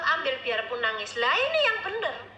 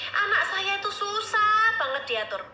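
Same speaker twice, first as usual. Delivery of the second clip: higher-pitched imitation of a whining parent; mock parental complaint; 7 semitones higher than usual.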